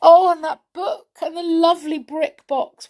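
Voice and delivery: usual speaking voice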